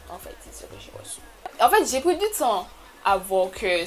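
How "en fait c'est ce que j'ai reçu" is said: breathy whispered repeat, fast; a hushed whispered repeat; whispered aside. Real, not acted. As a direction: whisper it to yourself, quickly.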